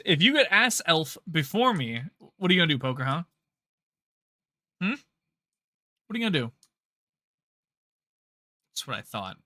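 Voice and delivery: mock-threatening taunt. Taunting a chat member.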